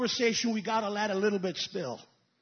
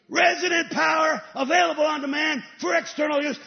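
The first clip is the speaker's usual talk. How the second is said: each word hurled out loud and high; shouted proclamation; 5 semitones higher, 8 dB louder than usual. Shown as a demonstration. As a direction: proclaim the definition to the rafters. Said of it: "and the word virtue means resident power".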